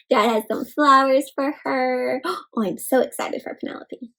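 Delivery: usual speaking voice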